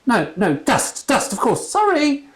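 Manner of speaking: high squeaky nervous voice; bumbling priest squeaking. A narrator's reading. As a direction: squeak it like a flustered young priest. Flustered priest keeps talking.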